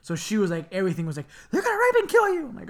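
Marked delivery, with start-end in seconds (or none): 1.5-2.5 high-pitched mock hysteria; imitated panicky mom. Mom to children after crime shows, imitated panic. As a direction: cry out a mother's worst fear. Mom's constant alarmed warning.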